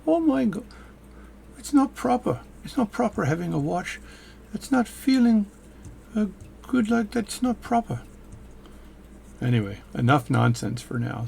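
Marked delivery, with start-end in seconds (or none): 0.1-8.0 very high-pitched British caricature; hammed-up impression of a British watchmaker, pitched way up; mock British accent. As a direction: put on a squeaky-high British voice. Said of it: "British watchmaking videos get tons of views".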